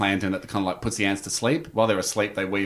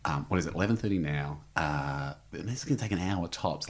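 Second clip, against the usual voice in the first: hushed scheming; 7 semitones lower, 11 dB quieter than usual. Retold or imitated. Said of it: "It's got me and Andy going".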